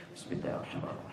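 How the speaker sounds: barely audible whispered bismillah; imam reciting silently. Acted. Over-zealous imam tries every practice, now whispered. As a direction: whisper it quickly under the breath. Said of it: "pernah disirkan".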